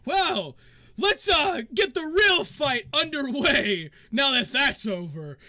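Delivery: loud, high showman's call rising to a shout; rally the crowd for the fight; hype-man projecting to the stands; arena announcer. Acted. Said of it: "and he goes".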